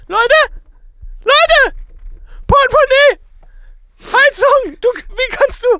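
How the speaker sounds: high hedgehog voice. Pretend. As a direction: shout for the others as a hedgehog. Hedgehog calls out others.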